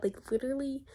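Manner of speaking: usual speaking voice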